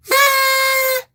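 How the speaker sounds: loud, sustained high whoop; high celebratory squeal. Real, not acted. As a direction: let out long, high squeals. Celebrating thanks to a donor.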